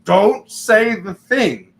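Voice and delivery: high, slow, tense plea of a nervous aura; pleading aura voice. Retold or imitated. Voicing someone's aura pleading silently.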